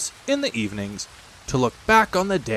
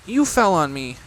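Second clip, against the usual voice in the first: mild complaint; 7 semitones higher, 3 dB louder than usual. A narrator's reading. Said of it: "said Piglet, feeling himself all over".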